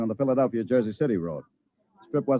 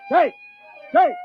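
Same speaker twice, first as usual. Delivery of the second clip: shouting a name across the room; calling out; 6 dB louder than usual. Acted. Gaines calls for Jake across the restaurant. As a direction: call him urgently.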